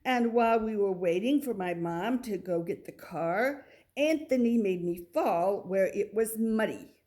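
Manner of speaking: usual speaking voice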